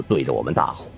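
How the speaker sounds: usual speaking voice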